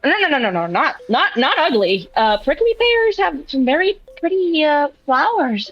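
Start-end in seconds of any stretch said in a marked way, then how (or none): none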